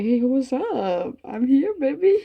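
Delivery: loud, drawn-out mock greeting, pitched high; hammed-up arrival greeting. Mom announcing her sudden arrival to the player, hammed-up.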